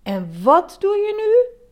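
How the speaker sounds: high, loud reproachful question; scolding. A narrator's reading. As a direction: catch someone disobeying. Elephant scolds himself for climbing.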